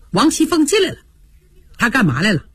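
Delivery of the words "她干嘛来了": ordinary delivery, nothing marked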